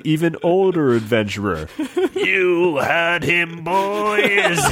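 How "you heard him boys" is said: put-on high, slow old-man holler; shouting. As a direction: call it out high and drawn-out. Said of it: "shouted the older man".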